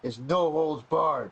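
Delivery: slow, dramatic ring announcer voice; wrestling commentator. Pretend. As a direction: stretch it out like a fight hype. Hyping next week's rematch.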